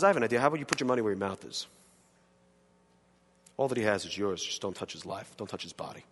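low, soft, calm God's voice; hushed God. Acted. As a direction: lay the deal out quietly and coolly. God voiced quietly to Satan.

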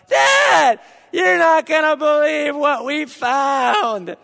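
put-on high, loud, thrilled kid yell with a laugh; breathless excited child shouting news. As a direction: burst in yelling at dad with glee. Kid running back shouting excitedly.

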